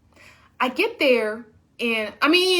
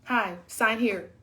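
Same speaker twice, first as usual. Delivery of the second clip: lower, slow, bored voice; curt receptionist; 6 semitones lower, 5 dB quieter, slower than usual. Retold or imitated. Receptionist greeting her, lower bored voice. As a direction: drop it flatly and slowly. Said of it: "she was just like".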